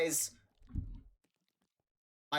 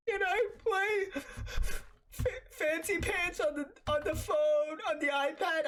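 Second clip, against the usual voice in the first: put-on pleading little kid. Hurt kid asking dad for the iPad, pleading. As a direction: whimper a request like a hurt child. Voicing himself as hurt seven-year-old.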